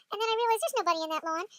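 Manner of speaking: ordinary delivery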